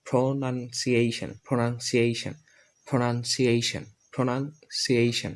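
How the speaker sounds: প্রনাউন্সিয়েশন in parts, "pronan-si-ay-shan", falling; new pronunciation. Shown as a demonstration. Teacher drills learners, প্রনাউন্সিয়েশন as "pronan-si-ayshan". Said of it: "এখন আমরা একটা উচ্চারণ করব".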